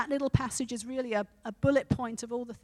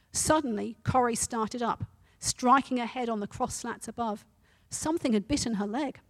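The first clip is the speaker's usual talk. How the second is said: a preacher reading a story to his church; 5 dB louder than usual. A narrator's reading. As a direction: tell the story with steady energy. Reads story aloud to congregation.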